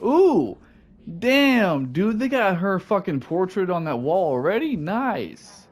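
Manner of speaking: thrilled at what you just saw; excited. Real, not acted. Excited at the portrait.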